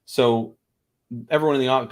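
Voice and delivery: ordinary delivery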